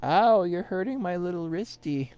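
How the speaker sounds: put-on high whiny baby talk; exaggerated cartoon intonation. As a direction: whimper like a hurt child. Babyish complaint in play.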